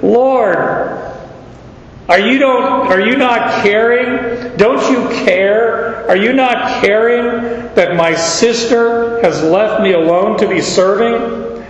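high, whiny, complaining Martha. Acted. Preacher voicing Martha griping to Jesus. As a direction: plead as an exasperated Martha.

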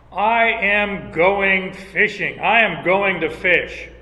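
slow, deliberate, firmly announced; determined Peter. Acted. Peter tells the disciples he's going fishing, slow and firm. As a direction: Peter states his mind, one word at a time.

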